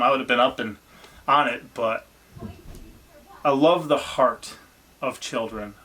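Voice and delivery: ordinary talk, nothing marked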